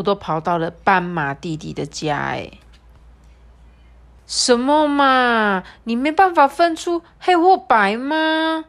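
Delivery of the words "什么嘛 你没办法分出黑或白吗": disappointed scolding animal. Acted.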